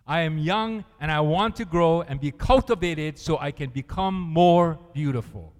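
loud, earnest prayer voice; reading a prayer aloud. Acted. Preacher reading a Haitian prayer to the congregation, loud and earnest. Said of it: "he said".

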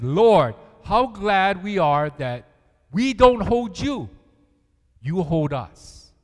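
loud, slow, earnest prayer; reading a prayer aloud. Acted. Preacher reading a prayer to the church, slow and loud. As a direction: lean into each word, slow and loud. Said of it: "He says".

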